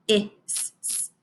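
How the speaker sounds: each letter sounded separately; slow letter by letter spelling; sounding out. Shown as a demonstration. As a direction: spell it out, K-I-S, one sound at a time. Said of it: "you don't say K-I-S".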